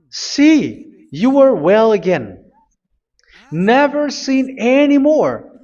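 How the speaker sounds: slow, high and solemn as if Yahushua himself speaks; voicing Yahushua. Preacher voices Yahushua quoting scripture.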